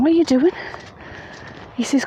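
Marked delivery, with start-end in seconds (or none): 0.0-0.5 raised, higher-pitched questioning call; mild retold shout upstairs. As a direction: call up to someone overhead, mildly. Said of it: "So I shouts up to him".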